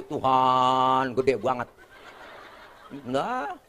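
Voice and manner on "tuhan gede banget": low, very drawn-out mock takbir; imam orang Betawi. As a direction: stretch it solemnly like a Betawi imam. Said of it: "Betawi maju". (takbir Betawi yang dipanjangkan)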